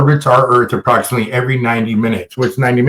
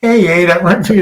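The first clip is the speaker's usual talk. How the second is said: normal talking voice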